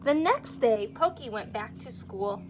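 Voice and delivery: lively storybook reading to a class; reading to children. A narrator's reading. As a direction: read it like story time for little kids. Reads picture book to class.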